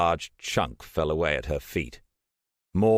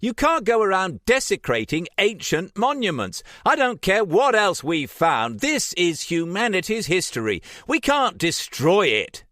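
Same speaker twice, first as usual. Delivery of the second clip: much higher, louder indignant cry; outraged; 6 dB louder than usual. A narrator's reading. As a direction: Trish furious at the damage.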